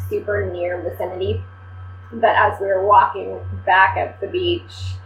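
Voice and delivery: normal talking voice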